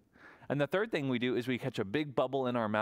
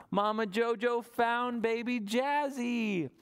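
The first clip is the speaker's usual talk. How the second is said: high, slow joyful reveal; delighted; 9 semitones higher, slower than usual. Acted. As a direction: beam it out to the kids. Happy ending of the search.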